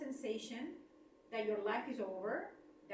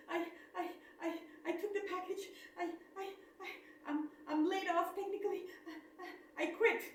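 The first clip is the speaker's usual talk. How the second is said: retold sobbing stammer; 8 semitones higher than usual. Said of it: "And I started bawling".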